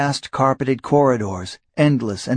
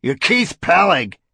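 irritable; 4 semitones higher than usual. A narrator's reading. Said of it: "Varric answered irritably".

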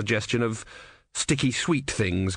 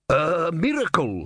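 dramatic vendor; 6 semitones higher, slower than usual. A narrator's reading. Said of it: "waving his hands dramatically".

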